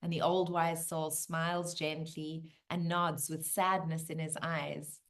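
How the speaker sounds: usual speaking voice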